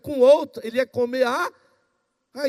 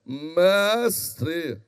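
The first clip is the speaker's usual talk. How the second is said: lower, drawn-out pious "Mestre"; religious intoned voice; 6 semitones lower than usual. Shown as a demonstration. Preacher demonstrating how religious voices change, intoned pious address. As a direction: intone it like a solemn churchman. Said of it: "daqui a pouco muda a voz".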